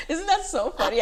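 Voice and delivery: high laughing question. Real, not acted. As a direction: laugh through the words. Laughing at ASMR.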